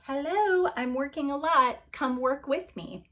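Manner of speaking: mock exasperation, pitching up at a slacker; exasperated. Overworked woman nags idle helpers, mock exasperated. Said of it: "I feel that way and I'm like".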